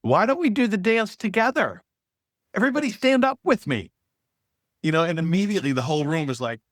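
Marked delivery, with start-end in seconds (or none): 0.0-3.9 eager teacher. Retold or imitated. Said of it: "she pretends".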